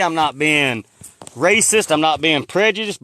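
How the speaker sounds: firm, loud denial; emphatic denial. Real, not acted. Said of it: "I want y'all to know".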